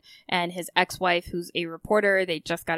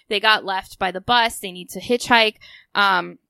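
normal talking voice

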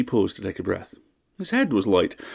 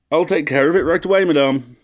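loud, higher reply; called back; 7 semitones higher, 7 dB louder than usual. A narrator's reading. Butler calling back to his mistress, loud. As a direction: call it across the house.

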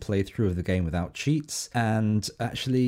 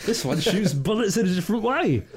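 mock excited gamer; 6 semitones higher than usual. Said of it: "I'm doing a bit".

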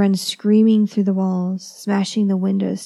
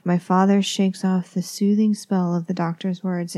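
ordinary delivery, nothing marked

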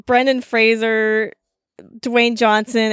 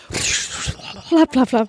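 parachute flapping noise; much slower than usual. Pretend. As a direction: voice a parachute fluttering open. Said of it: "flowy parachute noise".

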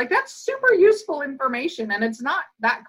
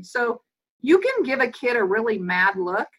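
ordinary talk

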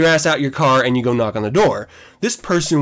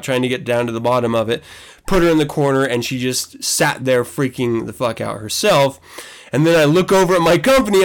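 usual speaking voice

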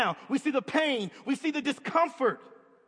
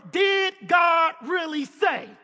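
Satan's tempting words; 5 semitones higher, 7 dB louder, slower than usual. Retold or imitated. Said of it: "Satan whispered those simple words".

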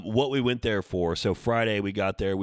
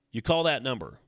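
ordinary talk

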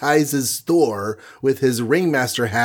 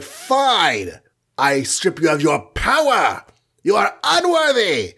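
lightly voiced wrathful Odin, high pitched; a hint of a booming god passing judgment; mock stern king; 6 semitones higher than usual. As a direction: Odin stripping his son of power, a touch of mock decree. Odin pronounces a sentence.

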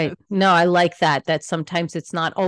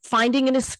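ordinary delivery

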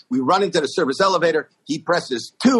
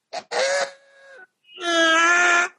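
very high, long wheel squeak; high imitation creak; imitated unoiled wheel squeak; slower than usual. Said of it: "There's no oil on the wheels".

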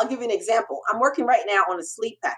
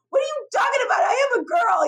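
very high-pitched mock shout; exasperated comeback, voice shooting up; retold indignant protest; faster than usual. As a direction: squawk back in disbelief, acting it out. Acts out her protest to mentor.

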